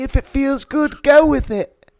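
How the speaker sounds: high-pitched waif impression; Charles. Quoting an imaginary friend's advice, high waif voice. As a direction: squeak it out like an overdone Tiny Tim. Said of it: "I was doing Charles".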